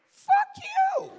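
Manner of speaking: full-force high scream; staged yelling. Acted. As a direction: scream the insult in a staged fight. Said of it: "start yelling".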